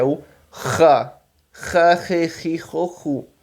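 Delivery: RA with a hard, rasped back-of-throat H; an H sound, but hawked from the throat; throat-scraped H. Shown as a demonstration. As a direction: scrape the R of "ra, re, ri, ro, ru" like hawking. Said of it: "só que escarrado".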